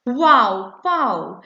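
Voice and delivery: mock shouted wow; excited wow. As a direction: burst out with the first wow. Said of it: "An exclamation mark shouts".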